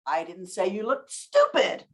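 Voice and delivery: slow, with stupid set apart and punched; stress on STUPID. Shown as a demonstration. Trainer shows another meaning of the statement, stress on stupid. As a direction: lean on stupid, implying another word was said. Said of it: "I said something else".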